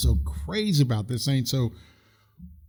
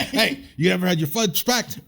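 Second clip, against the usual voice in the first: hammed-up gangster cop quoting a film scene; movie villain quote; 6 semitones higher than usual. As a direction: throw out the Training Day line like a gangster cop. Quoting Training Day movie line.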